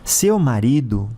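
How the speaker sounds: ordinary delivery, nothing marked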